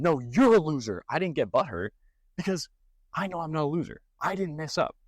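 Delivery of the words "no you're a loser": mock yell. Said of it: "I didn't yell back at him".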